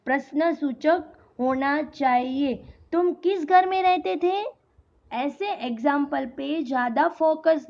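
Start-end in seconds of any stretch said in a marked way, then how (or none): 2.9-4.4 higher-pitched inquiry through "किस घर में"; raised questioning voice from "किस" onward; questioning tune. Shown as a demonstration. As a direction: ask it as a real question, held high to "थे". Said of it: "अगर आप कुछ पूछ रहे हो".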